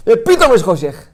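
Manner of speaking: loud preaching. Acted. Preacher crying out the sudden darkness to the congregation, loud. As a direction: proclaim it, then drop on darkness.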